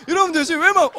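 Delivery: ordinary delivery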